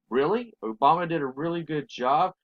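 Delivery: disbelieving echo, rising into a question; incredulous. Real, not acted. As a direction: throw the claim back as a doubtful question. Questions the quoted praise in disbelief.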